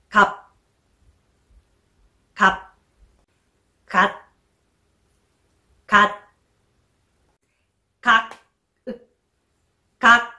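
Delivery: か cut short by an unreleased final p, t or k; stopped batchim endings. Shown as a demonstration. Teacher reads batchim examples, abrupt stopped endings. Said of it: "パッチムを入れて読んでみましょう".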